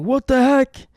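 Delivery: high-pitched startled squawk, no real volume; mock scream. Passenger discovering an injury in the plane bathroom, mock scream. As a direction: play alarm with the pitch shooting up. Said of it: "just start screaming".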